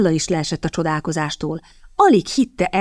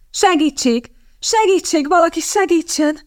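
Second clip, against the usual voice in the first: high-pitched, urgent calls; cry for help; 11 semitones higher than usual. A narrator's reading. Kitty calls for someone to help, crying out. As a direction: call out desperately, pitch soaring.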